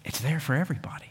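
hushed, breathy whisper. Real, not acted. Preacher to congregation about guilt, whispering. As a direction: let it fall to a whisper, fast.

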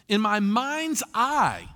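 slow emphasis. Shown as a demonstration. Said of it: "Isn't that funny how we use that phrase?".

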